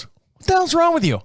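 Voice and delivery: high-pitched mock wife voice, puzzled; imitating wife. Wife questions husband rubbing his back, high mock voice. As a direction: squeak it out like a startled wife. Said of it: "My wife looks at me and goes".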